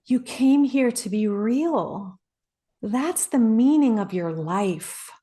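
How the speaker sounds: slow and weighty, each word landing; slow, deliberate mentor. Retold or imitated. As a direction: let the truth sink in slowly. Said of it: "This is how he talks to me".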